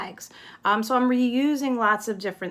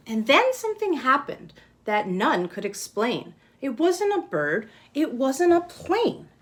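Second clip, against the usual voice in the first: lively read-aloud, louder and higher; storybook reading; 4 semitones higher, 4 dB louder than usual. Acted. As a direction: read the rhyme with energy to the class. Reads picture book aloud.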